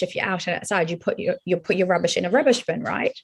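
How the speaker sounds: ordinary talk, nothing marked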